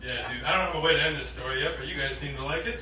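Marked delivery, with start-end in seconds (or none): none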